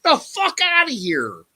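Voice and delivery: high, heated self-defense; retold angry rant. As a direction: re-enact ranting at a neighbor. Retold quarrel with the neighbor.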